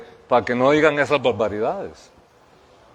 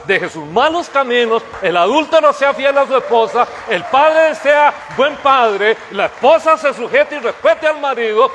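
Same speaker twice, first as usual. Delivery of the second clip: preaching at full force; 6 semitones higher than usual. Acted. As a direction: thunder it from the pulpit, building. Preaching the nation's salvation.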